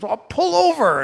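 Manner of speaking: high, loud urgent plea; wife's urgent high pull over. Retold or imitated. Wife urges sick husband to pull over, high and loud. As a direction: tell him to pull over now. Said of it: "And she goes".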